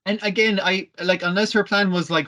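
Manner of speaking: normal talking voice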